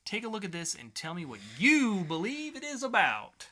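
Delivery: ordinary delivery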